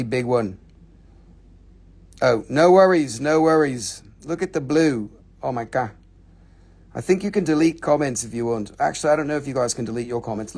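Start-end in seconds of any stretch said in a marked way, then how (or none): none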